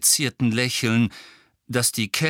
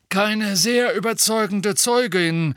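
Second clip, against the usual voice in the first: haughty; 11 semitones higher, 4 dB louder than usual. Acted. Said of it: "sagte Fudge hochmütig".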